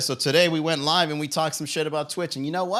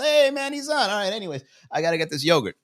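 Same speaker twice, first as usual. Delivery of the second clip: a much higher, excited bystander voice, settling down by the end; casual viewer; 7 semitones higher than usual. Pretend. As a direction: chirp it like a guy spotting the stream in the Publix line, then shrug it off. Imagined viewer in grocery line.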